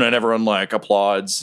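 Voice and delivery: normal talking voice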